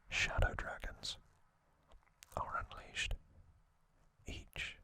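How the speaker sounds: usual speaking voice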